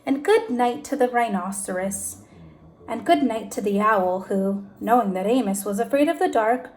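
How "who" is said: ordinary delivery, nothing marked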